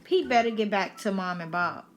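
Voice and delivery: usual speaking voice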